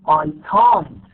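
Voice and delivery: CAN'T punched loud with a long, full vowel; stress on CAN'T. Shown as a demonstration. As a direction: hit "can't" hard and hold it. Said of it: "فلازم نحطها نعمل لها stress".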